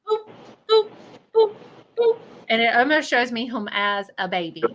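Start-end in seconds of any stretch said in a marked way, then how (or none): 0.1-2.2 high-pitched little boops; imitated boops. Medium imitating a spirit's playful noises to a client, high boops. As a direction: pipe out short, high boops. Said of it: "he goes".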